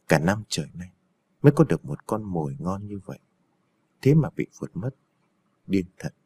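low, quiet grumble to himself; grumbling villain. A narrator's reading. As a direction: mutter your frustration, low and soft. Villain grumbling over lost prey.

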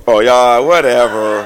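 loud teasing shrug; playful brush-off. Real, not acted. Preacher to laughing congregation, loud playful dismissal. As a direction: wave them off.

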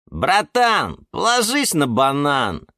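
high, put-on pally voice; high-pitched cheeky reassurance; blurting banana character. A narrator's reading. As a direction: blurt it out matily. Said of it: "брякнул банан Степан".

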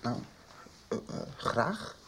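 shy, slow, whispered hesitation; hesitant whispered thanks; timid whisper. Acted. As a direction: barely voice it, too shy to ask. Shy boy accepting tea hesitantly.

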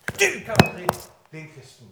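lett spilt demonisk stemme, høyt på første ord; dramatisert Satan-stemme. Retold or imitated. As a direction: en antydning av en truende djevel. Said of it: "dramatisere en preken her". (lett dramatisert Satan)

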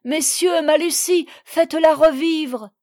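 high, loud, urgent plea; crying out. A narrator's reading. Husband begging others to revive his wife, distraught. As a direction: call desperately for help. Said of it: "cria-t-il".

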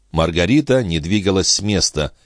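ordinary delivery, nothing marked

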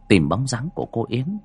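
usual speaking voice

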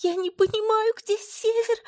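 very high, sobbing cry; angry crying. A narrator's reading. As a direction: sob out the words. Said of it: "всхлипнула я".

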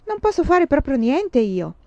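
put-on shocked girl denying loudly; exclaiming. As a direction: burst out in denial. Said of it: "esclamò".

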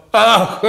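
high, loud "ah" outcry; sharp cry. Acted. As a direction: cry out suddenly. Cry without words. (lauter, hoher Aufschrei)

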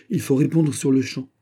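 insistent urging. Acted. The queen mother insisting her son answer now. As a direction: press the point again. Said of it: "s'écria Anne d'Autriche".

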